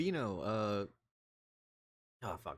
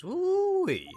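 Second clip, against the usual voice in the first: loud, very high "woo-wee"; cowboy whoop; 10 dB louder than usual. Acted. [name] cheering over fine ribs, cowboy whoop. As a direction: holler it like a rodeo rider. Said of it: "I love doing the cowboy voice".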